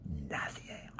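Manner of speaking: breathy menacing whisper. Acted. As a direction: hiss it like a secret threat. Susurro del personaje. (susurro amenazante)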